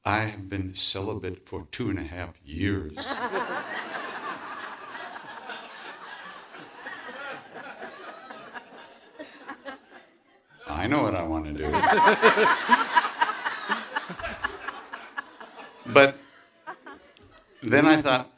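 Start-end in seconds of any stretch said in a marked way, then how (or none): 3.0-8.8 long, much higher real laugh, softer; high laughter. Real, not acted. Laughing at his own story. 11.8-15.9 much higher, louder voiceless laughing; breathless laughter. Real, not acted. Laughing at his own story.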